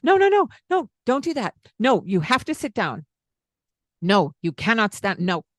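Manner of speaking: sharp, loud, high no-no scolding; harsh scolding. Shown as a demonstration. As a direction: snap at the child to sit down. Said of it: "I'm showing you the tone of voice that we might use".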